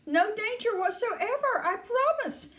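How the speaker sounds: high-pitched insistent soothing; high, emphatic Mr Wonka; crying reassurance. A narrator's reading. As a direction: insist all is fine, pitch held high. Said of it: "cried Mister Wonka".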